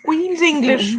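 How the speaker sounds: mock plummy Queen's English; lightly hammed-up upper-class English; posh RP. Mocking her friend's RP accent, mild plummy voice. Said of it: "I have a very R P accent".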